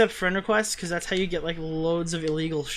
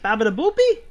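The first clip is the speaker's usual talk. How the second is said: hammed-up Italian accent, louder and higher; mock Italian accent; 5 semitones higher, 7 dB louder than usual. Streamer unsure how to do the requested accent, mock Italian. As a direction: try a cartoon Italian accent, tossed up as a question. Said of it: "do your thickest Italian accent?".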